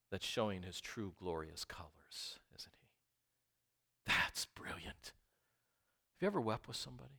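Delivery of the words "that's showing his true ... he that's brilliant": whispered awe. Acted.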